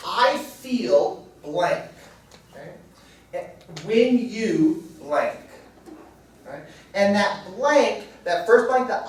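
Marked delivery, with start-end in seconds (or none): 0.1-1.8 each word spaced out slowly; teacher reading the formula slowly; slow template. Shown as a demonstration. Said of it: "that says". 3.8-5.4 slowly drawn out, word by word; instructor's deliberate slow read; slow template. Shown as a demonstration. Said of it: "So the speaker starts off".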